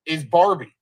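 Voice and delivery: ordinary delivery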